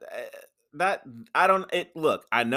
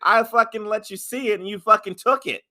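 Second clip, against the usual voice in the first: mock Rock rant; 9 semitones higher, 7 dB louder than usual.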